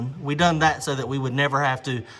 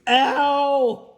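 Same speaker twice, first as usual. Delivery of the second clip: pained yelp; 11 semitones higher than usual. Real, not acted. Toe still hurting.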